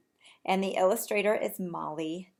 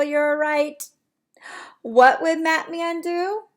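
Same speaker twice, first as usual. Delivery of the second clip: higher, louder, cheering them on; excited praise; 9 semitones higher, 9 dB louder than usual. Real, not acted. Teacher praising her class's answer, excited. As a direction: reward the right answer with energy.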